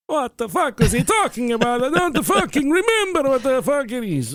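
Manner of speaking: high-pitched muddled Italian voice; squeaky confused young Italian. Acted.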